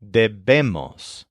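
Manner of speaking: "debemos" slowly, stressing the middle "be"; slow teaching demo of "debemos", second syllable stressed. Shown as a demonstration. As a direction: land on BE in "debemos", drawn out. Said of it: "the stress is on the second syllable".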